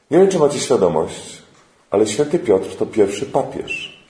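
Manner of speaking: ordinary talk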